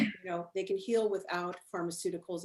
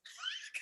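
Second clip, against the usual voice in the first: burst of laughter; 10 dB quieter than usual. Real, not acted. Guest laughs at the crone's strange claim, breathy laugh. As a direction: laugh in disbelief at the odd remark.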